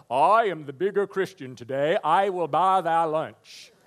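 high, loud, hammed-up pious proclamation; mock self-righteous. Churchgoer showing off a good deed to someone disliked, mock self-righteous. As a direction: proclaim it grandly, stretching the last words. Mocks boasting while paying for lunch.

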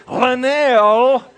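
loud high call of the name; shouting a name. Shown as a demonstration. Shouting for a family member from another room. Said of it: "After you've shouted".

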